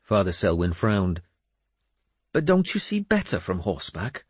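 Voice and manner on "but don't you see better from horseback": high-pitched questioning voice; puzzled priest. Acted. Priest asks about seeing from horseback, puzzled. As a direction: ask it high, as a doubting layman.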